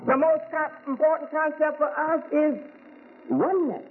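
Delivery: preaching. Acted. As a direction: lift it up and preach to the congregation. Rising into full preaching.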